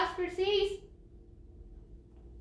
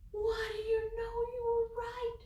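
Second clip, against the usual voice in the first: quiet and amazed; hushed surprise, a bit fast; surprised hush; 8 semitones higher, 6 dB quieter, faster than usual. Acted. Surprised reveal.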